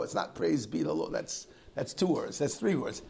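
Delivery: ordinary talk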